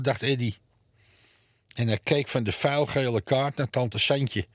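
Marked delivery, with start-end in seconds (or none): none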